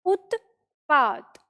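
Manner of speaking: उत्पात said very slowly, each sound held; slow dictation. Shown as a demonstration. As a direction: dictate उत्पात slowly so students can write it. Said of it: "इस तरह से हम लिखेंगे". (उत्पात को धीरे-धीरे लिखवाते हुए)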